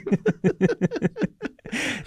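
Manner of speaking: high, running laugh; real laughter. Real, not acted. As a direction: crack up after a dark joke. Laughing at his own dark joke.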